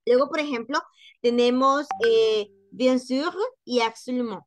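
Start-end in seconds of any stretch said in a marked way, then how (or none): none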